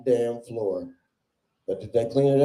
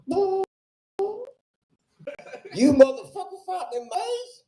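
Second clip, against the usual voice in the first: much higher, cracking, laughing shriek; shrieking disgusted victim. Acted. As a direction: squeal it out in mock horror. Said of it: "the actress's shocked reaction".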